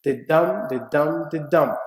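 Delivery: da DUM da DUM, stress on each dum; iambic beat. Shown as a demonstration. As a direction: tap out the iambic rhythm.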